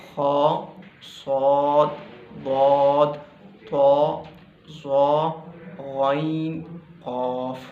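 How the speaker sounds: "खा", "सौद", "बौद", "तो", "ज़ो", "गैन", "कॉफ" each said full and heavy; heavy letters demo. Shown as a demonstration. Teacher sounding the heavy letters for students, emphatic. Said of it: "मैं आपको बता देता हूँ".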